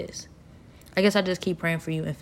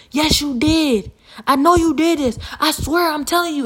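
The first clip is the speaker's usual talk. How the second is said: high, loud imitation of an accuser; insistent accusation; 9 semitones higher, 7 dB louder than usual. Blamer confronting the wrongly accused person, insistent.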